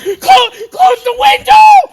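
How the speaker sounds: very high, very loud scream of an order; frightened kid yells at the babysitter to shut the window; panicked shout. Acted. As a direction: scream it in terror.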